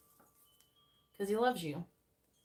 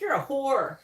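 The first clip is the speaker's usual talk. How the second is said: loud, higher mock jab; angry insult; 6 semitones higher, 7 dB louder than usual. Bitter ex loudly hurls an insult after rejection. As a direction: snap it back like a bitter ex. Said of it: "And they're like".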